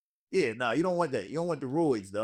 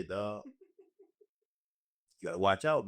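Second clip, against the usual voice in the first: ordinary talk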